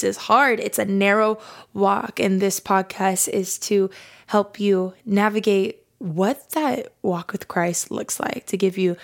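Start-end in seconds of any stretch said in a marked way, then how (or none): none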